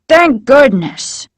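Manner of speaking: slow, drawn-out relief; relieved exclamation. A narrator's reading. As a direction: sigh it out with relief.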